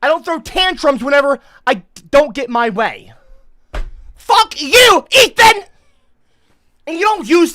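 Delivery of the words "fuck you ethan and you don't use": high, very loud yell; furious shout. Real, not acted. Streamer cursing at the chatter Ethan, furious shout. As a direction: hurl it straight at him.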